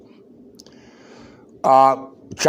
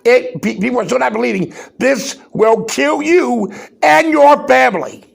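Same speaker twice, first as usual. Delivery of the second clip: raised voice, climbing louder to your family; real yelling; 6 semitones higher, 4 dB louder than usual. Real, not acted. Host yelling at his audience to take the virus seriously, building shout. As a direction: shout the warning at the listeners. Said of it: "I'm out here screaming".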